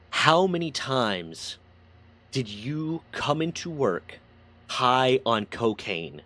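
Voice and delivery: drawn-out interrogation, word by word; measured, incredulous questioning; slow, pointed questioning. Retold or imitated. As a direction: retell an admiral's slow, deliberate demand. Admiral slowly questions the confessing sailor.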